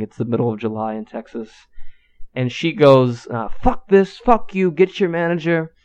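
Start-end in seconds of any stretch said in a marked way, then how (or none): 3.5-5.7 high-pitched mock outrage, falling off at the end; pitched-up angry customer impression; retold cussing out. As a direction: imitate an irate customer cursing out a cashier. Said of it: "She's cussing me out".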